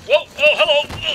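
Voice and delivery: high, loud burst of surprise; startled yell. Real, not acted. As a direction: yelp as if jumped by an enemy. Startled by sudden enemy in game.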